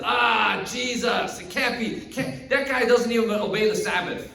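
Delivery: high, loud scoffing outburst; scornful disbelief. Acted. Pharisees dismissing the man who broke the Sabbath, scornful disbelief. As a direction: scoff as if the name is absurd.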